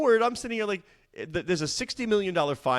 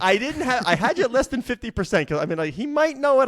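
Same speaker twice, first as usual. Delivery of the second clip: higher and louder, thrilled the answer is right; excited cheer; 7 semitones higher, 4 dB louder than usual. Real, not acted. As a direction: celebrate like a quiz host whose contestant nailed it. Cheering a correct quiz answer.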